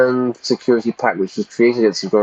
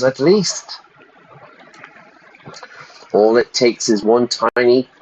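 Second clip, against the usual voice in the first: ordinary talk, nothing marked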